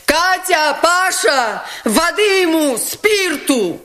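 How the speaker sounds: high urgent calls; calling out. A narrator's reading. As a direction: call the maids urgently. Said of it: "крикнула Настасья Филипповна".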